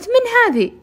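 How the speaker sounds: high, loud startled question; mother spots a stranger, startled; mother asks loudly who this is; surprised mother. Retold or imitated.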